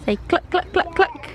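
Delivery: high, soft singsong coaxing. Real, not acted. Mum teaching chicken sounds, high soft baby talk.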